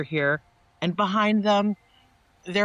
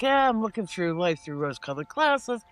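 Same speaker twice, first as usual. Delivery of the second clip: mock rosy denial; 6 semitones higher than usual. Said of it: "it's like".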